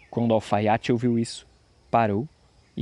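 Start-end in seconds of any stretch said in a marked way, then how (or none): none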